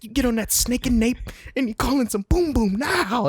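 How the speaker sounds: high mock commander insult; mock yelling commander. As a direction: bark the insult at the rookie.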